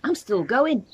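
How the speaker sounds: put-on screechy old voice. A narrator's reading. Grandma shouts from the attic, screechy and thrilled. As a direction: screech it from above. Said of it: "came the screechy old voice".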